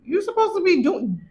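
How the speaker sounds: mock pep talk to yourself; self-scolding inner voice. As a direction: hype yourself up like a pushy friend. Inner voice pushing her to work.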